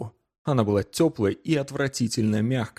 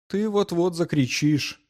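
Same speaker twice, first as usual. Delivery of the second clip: higher-pitched, lightly chiding; a touch of reproach in a raised pitch; mild reproach; 7 semitones higher than usual. A narrator's reading. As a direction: chide gently, voice lifted. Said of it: "с лёгким укором произнёс Уивер".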